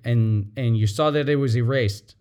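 ordinary talk

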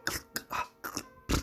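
whispered klick, ah, klick cracking noises; voiceless snapping sound effects; whispered clicks. Retold or imitated. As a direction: breathe out the clicks like tiny cracks. The cracking he hears while punching.

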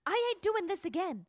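ordinary delivery

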